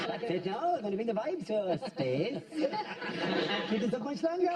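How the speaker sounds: laughing Welsh DJ voice; lightly done Welsh DJ bragging; Welsh accent. Pretend. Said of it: "You keep doing that Welsh accent".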